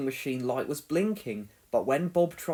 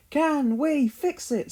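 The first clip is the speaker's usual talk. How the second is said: a slow, higher-pitched chant to rouse the team; rallying call; 10 semitones higher, 4 dB louder, slower than usual. A narrator's reading. Scoop rallies the team, a slow, high rallying call. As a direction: call it out slowly to get everyone going.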